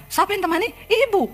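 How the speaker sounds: high startled disbelief; voicing a startled preacher; shocked question. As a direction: recoil in disbelief at the claim. Shocked preacher questions accusing woman.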